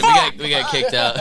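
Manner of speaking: high, laughing confession. Real, not acted. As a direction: crack up mid-sentence. Laughing recalling the story.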